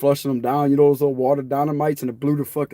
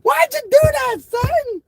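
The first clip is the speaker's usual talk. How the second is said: very high, slow mock mama outrage; imitating mama; slower than usual. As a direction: squeal it like a furious mom. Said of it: "She said it just like that".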